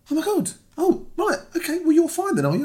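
very high imitated caller voice; taken-aback caller. Counsellor realising he is busy working, taken aback.

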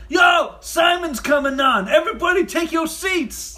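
high, loud, stretched-out shout; hyped-up bro announcer. Retold or imitated. Office guy rallying the room, shouted and high. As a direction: herald the boss's arrival. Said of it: "the one guy goes".

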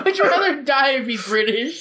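giggling through the words; laughing. Real, not acted. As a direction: laugh while asking.

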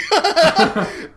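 loud squealing laugh; high burst of laughter. Real, not acted. Laugh after quote.

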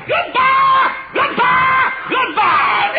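shrill high yelled goodbyes, way up and loud; hollering goodbye. Retold or imitated. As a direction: holler goodbye after the walkouts. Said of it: "I got to hollering".

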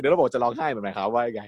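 normal talking voice

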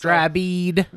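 put-on playful Southern accent. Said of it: "go back to the southern accent".

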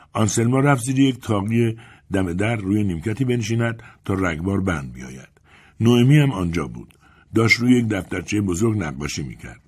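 ordinary delivery

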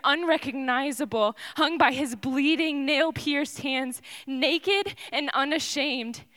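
normal talking voice

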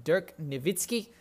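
light German accent on the name; attempted German accent. Shown as a demonstration. Sports host reading the contestant list, attempted German accent. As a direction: try the name with a hint of German. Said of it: "I tried to say Dirk Nowitzki in a German accent".

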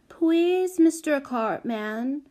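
high, sweet, drawn-out plea; wheedling little girl. A narrator's reading. Small child charming the sour carter, wheedling. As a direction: plead like an adorable small child. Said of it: "doing the batting thing with her lashes".

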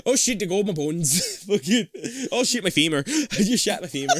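high, giggly joking; real laughing. Real, not acted. Friends riffing on gummy bear effects, laughing. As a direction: squeeze the words out through laughter.

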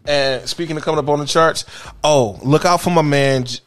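normal talking voice

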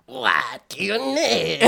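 slow, drawn-out creature voice; lightly voiced lizard man. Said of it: "their voices were funny".

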